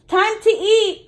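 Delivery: high, slow call across the house. A narrator's reading. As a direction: call the family to dinner. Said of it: "Mom called".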